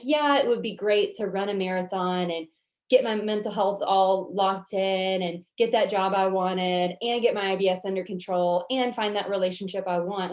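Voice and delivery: ordinary talk, nothing marked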